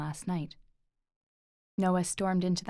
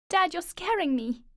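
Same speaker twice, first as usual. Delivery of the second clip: high, loud panicked plea; frightened shout; 12 semitones higher, 5 dB louder than usual. Acted. Boy begging his unconscious father to wake, panicked. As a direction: cry out to Dad, scared.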